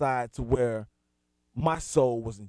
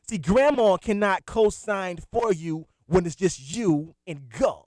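ordinary delivery

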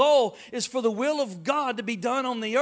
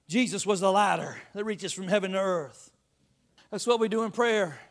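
normal talking voice

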